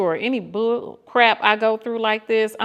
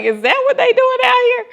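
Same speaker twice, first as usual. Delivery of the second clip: much higher, louder, disbelieving; mock shock; 8 dB louder than usual. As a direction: squeal it in disbelief. Shocked reaction to flirty message.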